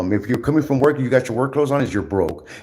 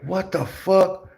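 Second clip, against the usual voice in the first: baffled retort; 8 semitones higher, much slower than usual. Retold or imitated. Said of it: "I was like".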